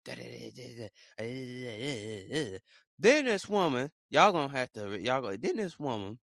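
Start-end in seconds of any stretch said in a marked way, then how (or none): 0.1-2.6 low, quiet babble imitating fussing; mock fussing. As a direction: imitate nonstop fussing in nonsense syllables. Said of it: "she just fussing the whole time".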